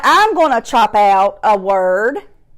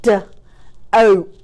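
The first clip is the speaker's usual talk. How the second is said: "D, oat" split into separate sounds; chopped-out word; 2 semitones lower than usual. Shown as a demonstration. Teacher repeating a word for pupils, chopped apart. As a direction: sound out DOTE with gaps. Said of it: "let's do one more".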